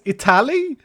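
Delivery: Italy with a stressed tal, it-TAL-y; Italy stressed on the second syllable; odd Italy. Shown as a demonstration. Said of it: "He said Italy?".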